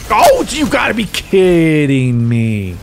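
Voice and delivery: high, slow cry of disbelief; exasperated outburst. Real, not acted. Player just killed holding heavy ammo, exasperated. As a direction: wail it in drawn-out frustration.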